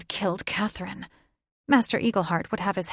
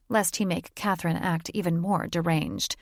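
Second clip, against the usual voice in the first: plain reading voice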